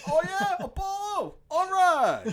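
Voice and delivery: Rocky's reply. Retold or imitated. Fan imitating Rocky on the phone, hammed-up. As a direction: ham up his phone greeting. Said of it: "He's like".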